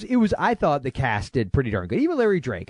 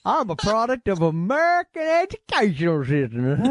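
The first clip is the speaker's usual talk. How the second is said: mock dumb American; 6 semitones higher than usual. Mocking voice after accent bit.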